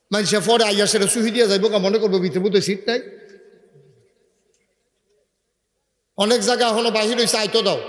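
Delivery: loud orders. Real, not acted. Preacher loudly orders volunteers to keep the door clear. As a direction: call out to the volunteers over the crowd.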